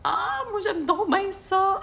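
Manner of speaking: high, slow, delighted viewer; gushing fan voice. Acted. Reading a viewer's comment to the audience, high and delighted.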